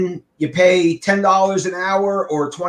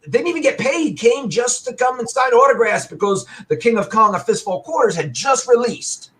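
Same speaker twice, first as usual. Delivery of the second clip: high-pitched mock outrage; retold indignant rant; 8 semitones higher than usual. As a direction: grumble it like a retold angry rant. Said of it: "I was pissed off and I was talking to people".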